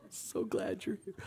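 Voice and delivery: high joyful cry then hushed; overjoyed relief. Retold or imitated. Disciples greeting risen Jesus, joyful high cry. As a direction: gasp with relieved joy. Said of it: "'Cause they're like".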